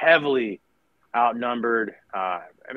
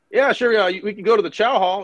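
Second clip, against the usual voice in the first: dismissive; 9 semitones higher, 4 dB louder than usual. Retold or imitated. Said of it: "He's just like".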